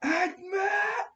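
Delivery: loud, very high desperate yell; Anatar. Acted. Anatar yelling as he falls into containment, high loud yell. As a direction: yell it out, pitched far up. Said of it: "You hear him yell".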